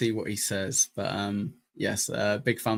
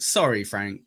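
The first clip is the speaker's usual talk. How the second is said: ordinary delivery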